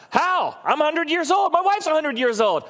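put-on can't believe it, he's far too old; incredulous Abraham. Abraham, doubting God's promise of a son, disbelieving protest.

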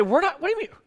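hammed-up high-pitched disbelief; mock innocent denial. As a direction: act baffled at the accusation. Crowd's acted denial of the accusation.

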